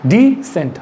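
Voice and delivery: डिसेंट with a light d, then "sent"; डिसेंट, a light di then sent, the teacher's model; model pronunciation. Shown as a demonstration. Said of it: "Pronunciation is".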